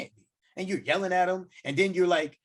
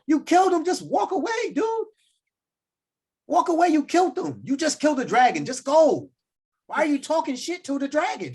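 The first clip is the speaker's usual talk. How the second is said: exasperated yelling; 7 semitones higher than usual. Real, not acted. Yelling at Turin over dragon.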